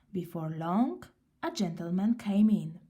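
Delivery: ordinary delivery, nothing marked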